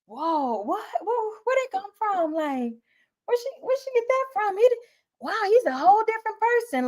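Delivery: high, fast, stunned disbelief; wide-eyed boss blown away by a teacher's turnaround; amazed administrator. Pretend. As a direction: gush like a boss who can't believe the change. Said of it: "have your administrator looking like".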